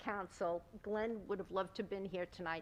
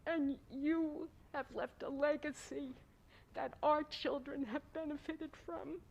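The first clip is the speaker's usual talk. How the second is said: getting emotional; 6 semitones higher than usual. Real, not acted. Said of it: "And now I'm gonna get emotional".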